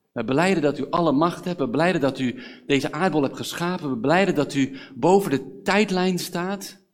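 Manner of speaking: loud, emphatic prayer; fervent confession. Acted. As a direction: proclaim it to God with building force. Declares faith in prayer to God.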